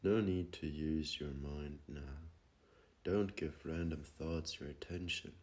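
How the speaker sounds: ordinary talk, nothing marked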